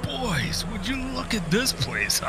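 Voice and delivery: put-on high, thrilled call to the boys; excited calling out. Dad calls his sons to see the rental, thrilled and high. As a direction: show off the place, bursting with glee.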